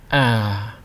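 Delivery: "Ah" tapering downward; falling tone. Shown as a demonstration. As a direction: ease off the air as "Ah" falls. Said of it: "pushing out less air as you go down".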